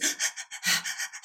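quick, shallow demonstration breaths; shallow breathing. Shown as a demonstration. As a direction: pant lightly to show short breathing. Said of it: "like, shallow breathing".

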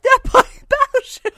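high, loud, slow amazement; drawn-out high exclamation of delight; mock amazed. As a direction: act stunned that they're actually playing the game. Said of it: "I was like".

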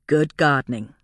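usual speaking voice

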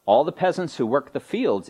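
normal talking voice

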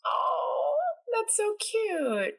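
high, squealing delight over a puppy; gushing. Real, not acted. Gushing to her friend about huskies, high cooing delight. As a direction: coo at it like a cute puppy.

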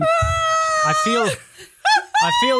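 very high, squealing laugh through the words; laughing shriek. Real, not acted. Host squeals with laughter mid-sentence. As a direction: lose it while trying to talk.

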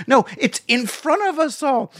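usual speaking voice

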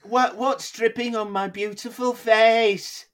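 high, slow, vain mock voice; hammed-up shock at the rain; mock startled. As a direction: pretend vain scientist amazed by rain. Voicing startled Brian Cox.

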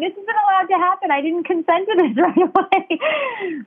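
high, laughing protest; half-laughing panic about the surprise; panicked, laughing. Retold or imitated. Recalling her reaction to the test, high and laughing. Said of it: "I'm like".

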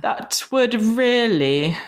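slow, stretched posh British attempt; posh British accent. Shown as a demonstration. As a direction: mimic a drawn-out posh British voice. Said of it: "a posh British accent".

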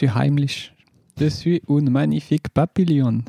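put-on light French with a German accent; a hint of German accent on the words. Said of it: "ça passe toujours l'accent allemand".

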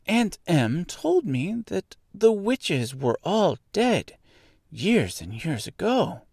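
put-on high, slow girl's voice; earnest little girl. A narrator's reading. Dorothy telling the witch what her aunt said, earnest. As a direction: puzzle it out in a high child's voice.

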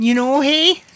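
a light Midwest Wisconsin lilt on the tag; ribbing cohost over his Wisconsin accent, Wisconsin twang. Pretend. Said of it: "my Wisconsin accent".

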